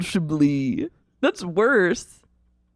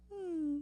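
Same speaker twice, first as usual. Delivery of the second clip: skeptical hum; 11 semitones higher, 17 dB quieter than usual. Retold or imitated. Unimpressed reaction to Carrie.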